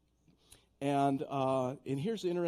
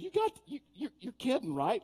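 mock disbelief; 9 semitones higher, 3 dB louder than usual. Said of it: "She goes".

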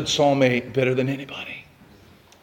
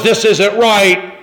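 disapproving; 3 semitones higher, 5 dB louder, much slower than usual. Retold or imitated. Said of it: "What they said was".

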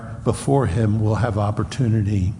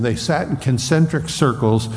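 ordinary talk, nothing marked

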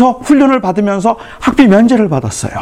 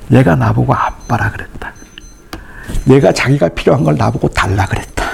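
normal talking voice

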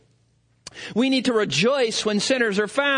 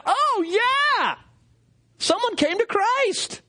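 much higher, jubilant; heavenly cheer; 12 semitones higher, slower than usual. Acted. Saints and angels in heaven, cheering joyfully. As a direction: celebrate like a crowd in heaven. Heaven celebrating a repentant sinner.

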